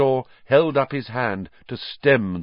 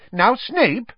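high, loud, startled interjection; 5 dB louder than usual. A narrator's reading. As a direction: step in, taken aback, pitched high. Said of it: "said Fudge, startled".

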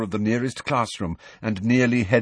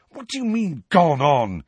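higher, quick demanding question; quickly; 7 semitones higher, 4 dB louder than usual. Acted. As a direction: fire it back fast. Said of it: "said Harry quickly".

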